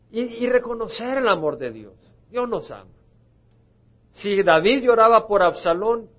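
rally the congregation, full voice; loud preaching. Acted. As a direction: pulpit exhortation at full force.

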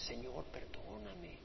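soft, slow begging for forgiveness; penitent's hushed, slowed prayer for pardon; tearful plea. Acted. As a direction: plead quietly, as if through tears. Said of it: "Y con lágrimas dices".

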